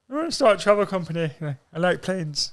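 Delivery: high-pitched silly put-on voice; naive self-mockery. Mocking his travel company pitch to the show, naive high voice.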